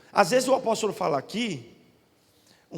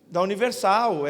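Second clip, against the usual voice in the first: ordinary talk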